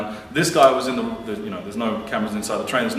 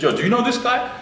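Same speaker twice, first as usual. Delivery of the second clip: put-on much higher, urgent call to the girls; retold call-out; 12 semitones higher than usual. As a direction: re-enact grabbing their attention. Confronting the girls on train.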